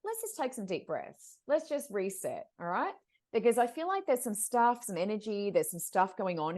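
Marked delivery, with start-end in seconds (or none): 0.0-3.0 soft and slow, settling her down; slow soft calming voice; calm, soothing. Retold or imitated. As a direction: gently coax a reset. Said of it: "But I said".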